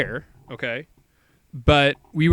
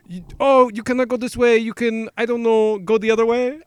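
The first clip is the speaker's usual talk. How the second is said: higher, light mock French accent; a hint of a French accent, pitched higher; French guy; 6 semitones higher than usual. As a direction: imitate a French guard turning them back, with a light French accent. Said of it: "literally verbatim what he said".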